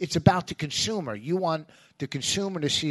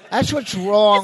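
ranting; 6 semitones higher, 9 dB louder, slower than usual. Real, not acted. Ranting about the country.

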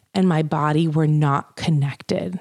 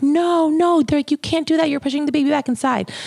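alarmed nurses; 9 semitones higher than usual. Retold or imitated. Nurses warning her she is pushing the baby back, alarmed. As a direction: stop her fast, alarmed.

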